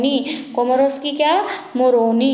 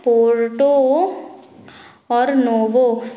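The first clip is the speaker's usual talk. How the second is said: slow broken repeat; much slower than usual. Shown as a demonstration. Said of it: "क्या है?".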